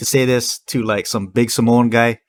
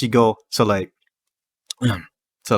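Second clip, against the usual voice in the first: ordinary talk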